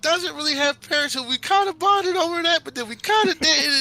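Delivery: very high, loud tearful rambling; crying babble. Acted. Midas introducing X to his parents, tearful. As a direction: pour it out in a high, weepy voice. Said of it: "And he's crying".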